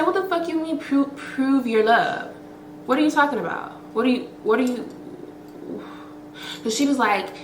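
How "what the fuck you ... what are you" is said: higher-pitched incredulous demand; mock-outraged what are you talking about; retold outrage. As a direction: disbelief at her friend's plan, pitched up. Outraged at friend on phone.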